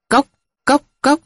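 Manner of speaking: high clipped tok tok tok of a wooden bell; wooden knock imitation. Crier's wooden bell beating, high vocal knocks.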